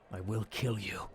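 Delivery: breathed threat. A narrator's reading. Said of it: "It breathes a voice you recognize".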